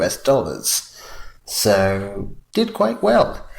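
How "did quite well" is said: dry understatement. Real, not acted. Dry understatement about the box office.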